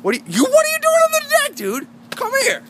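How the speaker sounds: very high, loud yelp at the dog; shrill scolding yell. Real, not acted. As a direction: shriek at the dog to come here. Yelling at dog on deck.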